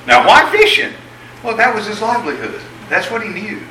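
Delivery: ordinary talk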